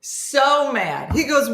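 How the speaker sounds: ordinary talk, nothing marked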